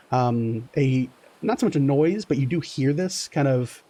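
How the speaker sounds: ordinary delivery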